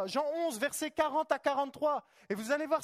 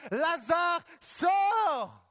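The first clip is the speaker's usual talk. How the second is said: Jesus calling Lazarus from the tomb, loud cry; 3 semitones higher, 6 dB louder, much slower than usual. Acted. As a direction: shout Lazare, sors high and loud. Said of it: "il cria d'une voix forte".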